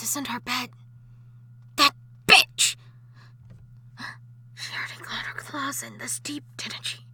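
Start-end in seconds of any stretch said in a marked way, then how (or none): 1.8-2.8 furious outburst. Acted. Jealous wife snarling about the rival in her husband's bed. 4.5-7.1 seething whisper. Acted. Ghost wife whispering hatefully beside her sleeping husband. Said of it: "I'll wake him".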